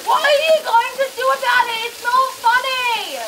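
high furious scolding; angry yelling. Real, not acted. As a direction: yell at him, outraged. Toilet flooded, scolding Zach.

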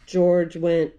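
plain reading voice

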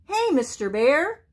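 high little possum voice calling. A narrator's reading. Little Joe getting the sobbing bear's attention, calling. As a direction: call up to him in a high voice. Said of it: "he called".

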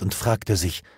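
usual speaking voice

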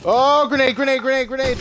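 loud, high grenade callout; grenade warning yell. Real, not acted. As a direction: shout grenade in a panic. Throwing grenade in panic.

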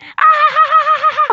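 high giggle burst; burst of laughter. Acted. Pepper cracking up at dad, high laugh. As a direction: laugh at dad's crude joke. Dad's joke lands.

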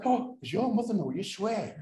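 high, prim mock reproach; tut-tut them like a prissy aunt; mock prim scolding. Teasing the swearing prison guards, high and prim.

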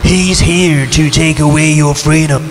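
ordinary delivery

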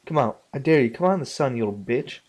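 ordinary talk

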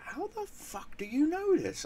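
high, slow mock disbelief; incredulous ex-wife. Ex-wife scared by what he knows, high mock incredulity. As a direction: stretch out her baffled question way up high.

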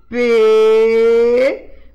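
P as a loud, long PÊ with closed E; closed E sound. Shown as a demonstration. Teacher showing pupils the closed sound, long loud PÊ for P. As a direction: hold "P" as a stretched, loud closed "pê". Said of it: "é um som fechado".

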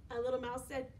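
usual speaking voice

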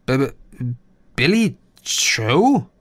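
slow, stunned stutter; stammering. Acted. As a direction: stammer in disbelief. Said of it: "fragte ich dämlicherweise". (fassungslos stotternd, langsam)

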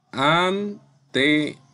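"Antemano" slowly in separated syllables; syllable-by-syllable demo. Shown as a demonstration. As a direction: sound out "Antemano" slowly with a gap after each syllable. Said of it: "Su sílaba tónica es ma".

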